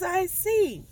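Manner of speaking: high, knowing doctor voice. Retold or imitated. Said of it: "She says".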